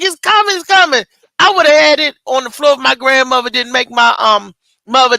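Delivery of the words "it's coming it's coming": higher, urgent it's coming; retold labor panic, voiced higher; mock urgent cry. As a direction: plead that the baby is coming. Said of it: "I'm like".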